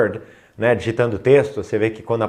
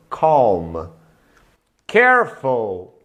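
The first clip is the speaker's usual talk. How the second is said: ordinary talk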